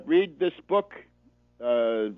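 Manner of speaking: ordinary talk, nothing marked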